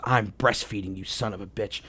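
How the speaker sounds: low, soft mock outrage; imitating his wife cursing him out; wife's low fury. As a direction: growl it quietly as the furious wife. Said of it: "She goes".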